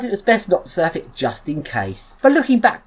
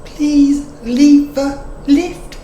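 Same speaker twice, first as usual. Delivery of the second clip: slow, higher-pitched mock announcement; imitating lift voice; 4 semitones higher, much slower than usual. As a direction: draw it out like an automated lift announcer. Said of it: "At least this voice says lift".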